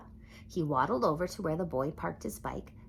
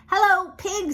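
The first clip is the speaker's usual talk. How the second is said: much higher, louder duck greeting; cheery duck; 9 dB louder than usual. A narrator's reading. Duck riding past the farm animals, high cheery greeting. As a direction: sing out hello like a jolly duck.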